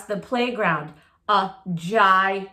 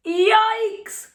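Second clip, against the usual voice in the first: yelped high and loud; a sharp high startled yikes; startled cry; 11 semitones higher, 4 dB louder than usual. Acted. Fish friend startled by Clark's hard push, high yelp. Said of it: "cried Joey Mackerel".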